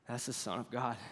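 very soft, low repeat in awe; understated hushed reverence; trembling awe. Acted. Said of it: "In a trembling voice".

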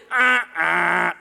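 loud wrong-answer buzzer noise; imitated buzzer. Shown as a demonstration. Preacher rejecting the world's lie, wrong-answer buzzer imitation. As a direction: buzz it like a game-show error.

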